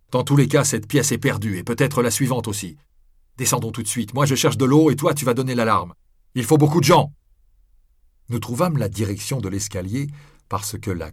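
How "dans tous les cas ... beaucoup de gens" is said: raised, pressing emergency instructions; urgent orders. A narrator's reading. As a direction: take command urgently, voice raised. Guillaume gives urgent orders in fire.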